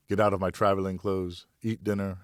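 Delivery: plain reading voice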